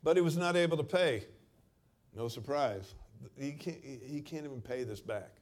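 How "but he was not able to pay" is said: loud, pressing sermon line; forceful preaching. Acted. Preacher stresses the servant's debt, loud and forceful. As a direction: drive the point home.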